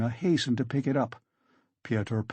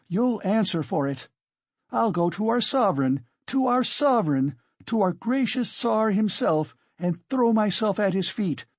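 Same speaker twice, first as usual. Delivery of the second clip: high-pitched overwrought tirade; frenzied, indignant woman, raging; 7 semitones higher than usual. Acted. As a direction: rail at them in a raised, urgent voice.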